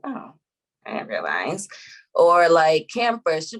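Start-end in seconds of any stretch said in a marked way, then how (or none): 0.0-1.7 low, near-whispered mock realization; imitated sheepish mother. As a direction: shrink it into a small, hushed admission. Mother's small, sheepish reply.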